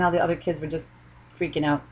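ordinary talk

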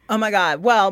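usual speaking voice

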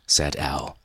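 ordinary delivery, nothing marked

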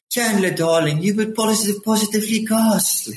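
imitating a sitcom guest star's fake British voice; Jennifer Coolidge British accent. Recalling a Friends episode for his co-host, in a mock British accent. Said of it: "where she goes".

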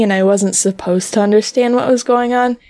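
ordinary talk

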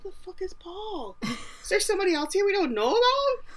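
put-on the girls squeaking in alarm about Paul; mock girls' alarm. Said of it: "all the girls are like".

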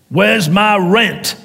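slow, high, loud demanding landlord; cold greedy landlord. Acted. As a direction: demand the rent like a bully landlord. Landlord demanding rent at door.

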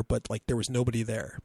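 ordinary talk, nothing marked

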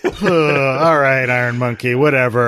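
loud, laughing brush-off; dismissive scoff. Retold or imitated. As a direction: scoff at it, amused and unimpressed. And I was like.

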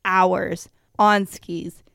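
ordinary talk, nothing marked

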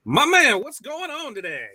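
big high hyped-up greeting; excited greeting. Real, not acted. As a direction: burst in with energy.

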